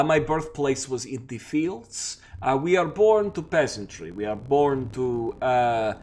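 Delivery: put-on Slavic-accented woodsman vampire, halting; thick Slavic accent, feeling for the words. Vampire describing his peasant birth, in a Slavic accent. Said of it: "put on my best Slavic accent".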